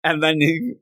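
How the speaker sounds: ordinary delivery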